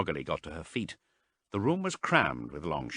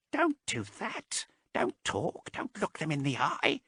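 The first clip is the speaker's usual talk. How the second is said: fearful; 10 semitones higher than usual. A narrator's reading. Marast fearfully pleading with Haas, high and hushed. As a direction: beg her, terrified, hushed. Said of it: "he said fearfully".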